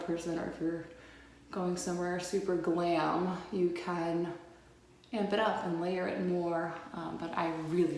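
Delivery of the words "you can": ordinary talk, nothing marked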